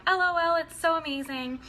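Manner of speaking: high, loud mock gush over the dress; gushing excitement. Boss texting her fan back, high loud gushing. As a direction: spell out L O L like a bubbly squeal.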